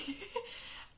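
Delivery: high incredulous laugh; burst of laughter. Real, not acted. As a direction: laugh in disbelief. Laughs at outrage.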